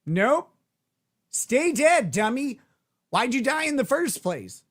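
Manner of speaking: high, punchy teasing refusal; mock scolding. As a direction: play an exasperated miracle worker refusing help. Acting out Jesus refusing to revive Lazarus.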